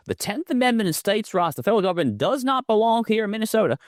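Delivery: Southern accent, pitched well up; thick Southern accent. Shown as a demonstration. Talk-show host parodying the attorney general in Southern accent. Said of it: "like, a thick Southern accent".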